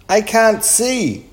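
high, loud, drawn-out complaint; dismayed cry. A narrator's reading. Chip has the flower pot on his head, dismayed cry. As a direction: cry out as if suddenly blinded.

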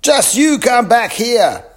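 high, loud, slow angry call; angry man calling the boys back; calling after them. A narrator's reading. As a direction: shout after the fleeing boys. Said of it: "called the man".

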